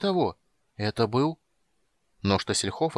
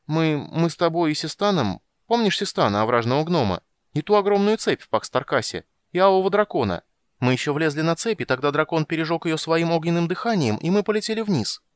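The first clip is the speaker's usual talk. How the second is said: high, loud, eager storytelling; excited retelling; 8 semitones higher, 4 dB louder than usual. Acted. Kender eagerly recounting the chain and the dragon. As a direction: gush through his favorite tale. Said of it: "История воодушевила Тасса".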